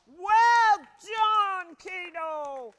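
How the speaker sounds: loud high cheer. Acted. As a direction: cheer the dog on. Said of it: "he cheered".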